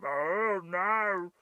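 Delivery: drawn-out mock groan of dismay; long, wailing comic "oh no"; imitating the screen. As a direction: stretch both words out like a machine giving up. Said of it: "The screen just went".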